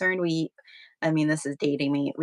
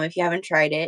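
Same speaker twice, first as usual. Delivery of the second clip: ordinary talk, nothing marked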